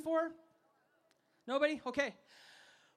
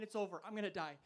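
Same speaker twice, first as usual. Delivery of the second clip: resigned inner voice; 6 semitones lower, 7 dB quieter, faster than usual. Retold or imitated. On the charging elephant, quietly sure he will die. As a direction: lower and softer, a doomed murmur. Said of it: "I'm on that elephant thinking".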